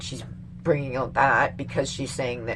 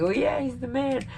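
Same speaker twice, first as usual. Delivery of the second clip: higher-pitched mock approval; mock approving crowd; 8 semitones higher, 4 dB quieter than usual. Everyone excusing Bill Clinton, mock approving. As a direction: lightly voice an admiring crowd. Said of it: "Everybody's all like".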